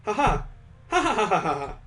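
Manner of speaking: high, bubbly burst of laughter; a mirthful girlish laugh; bright giggly laugh. Acted. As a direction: laugh like the tension just broke. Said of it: "she begins to laugh".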